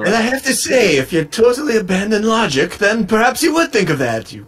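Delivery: high, haughty British lecturing voice; mock-posh British debater talking down; Richard Dawkins impression. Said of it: "My Richard Dawkins impersonation".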